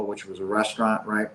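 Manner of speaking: ordinary talk, nothing marked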